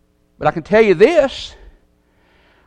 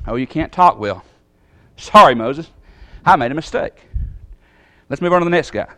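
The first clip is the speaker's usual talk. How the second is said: low, quiet and curt, brushing Moses off; dismissive God; 6 semitones lower, 5 dB quieter than usual. Pretend. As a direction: wave Moses away with a quiet shrug. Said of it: "Is that how it went? No".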